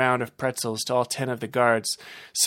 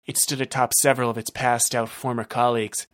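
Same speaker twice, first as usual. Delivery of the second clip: plain reading voice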